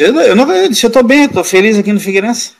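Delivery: high-pitched, insistent acted objection; protesting refusal. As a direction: dig in like a boy refusing to go. Young player resisting the transfer.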